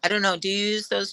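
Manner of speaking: ordinary delivery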